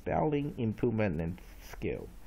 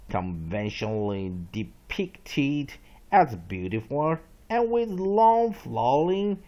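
loud, slow dictionary reading; read-along definition; 6 dB louder, slower than usual. Shown as a demonstration. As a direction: read it out emphatically for students to follow. Reads definition aloud. (大声慢速朗读释义)

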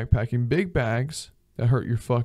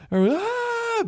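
mock panic scream. Imagined crash scream.

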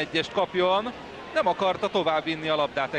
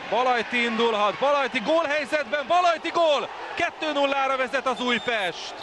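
building to a shouted goal call; excited Hungarian sports commentary; 6 semitones higher than usual. Real, not acted. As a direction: raise the pitch and roar gól. Second goal called live. (izgatott gólkiáltás)

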